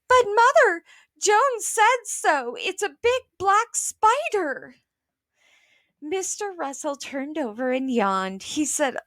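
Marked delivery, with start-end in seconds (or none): 0.1-4.7 put-on a child squealing to her mother.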